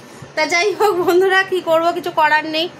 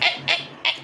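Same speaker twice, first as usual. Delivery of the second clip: breathy whisper call to a cat; 7 dB quieter than usual. Real, not acted. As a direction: whisper এই এই এই, no voice at all.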